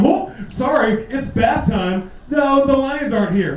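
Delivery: high, slow fake-apologetic voice; mock zoo staff excuse. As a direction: play the sorry zookeeper, stretched out. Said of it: "they're like".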